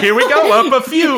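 teasing, shifting the voice up a notch. Retold or imitated. Host teases cohost about his work voice, pitching up. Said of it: "You're like".

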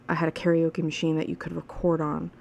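ordinary talk, nothing marked

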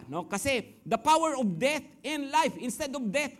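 normal talking voice

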